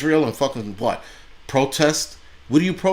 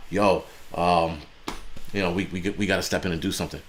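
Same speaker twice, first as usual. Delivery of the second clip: lower, fast mock serious talk; mock earnest politician; 5 semitones lower, 4 dB quieter than usual. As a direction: parody a politician's hurried solemnity. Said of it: "talking about".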